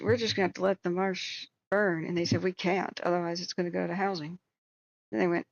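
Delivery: usual speaking voice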